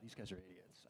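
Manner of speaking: very soft, low muttered judgment; muttered thought. Retold or imitated. Preacher quoting his old thought, very soft and low. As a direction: say it under your breath. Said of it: "to have the thought".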